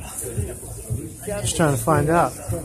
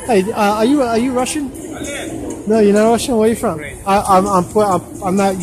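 bright raised voice calling to a stranger; overly polite approach; 5 semitones higher than usual. Real, not acted. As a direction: hail a passerby, eager and friendly.

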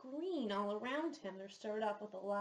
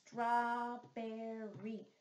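strawberry stretched very slowly; teacher prompting the answer; drawn out; much slower than usual. Shown as a demonstration. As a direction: draw out strawberry for the kids. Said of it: "Do you remember".

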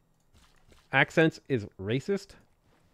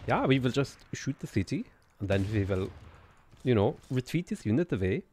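lilting, put-on German accent; mock German accent; 3 dB quieter than usual. Streamer narrating game moves for fun, mock German accent. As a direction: play a bad German accent drifting toward Swedish. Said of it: "do the German accent".

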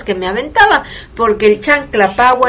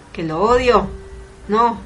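ordinary talk

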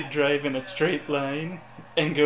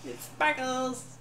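high, slow, awed gushing; 5 semitones higher, 6 dB quieter, slower than usual. Pretend. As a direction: coo over the sparkles. Gushing over sparkly car.

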